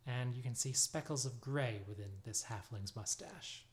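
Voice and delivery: ordinary talk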